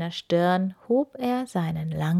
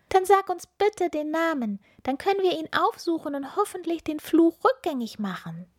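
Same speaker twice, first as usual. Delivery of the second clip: put-on very high, bright child voice; little witch girl; 10 semitones higher than usual. A narrator's reading. Little witch Ilvi introducing herself to the hermit, high child voice. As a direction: play a small eager witch girl.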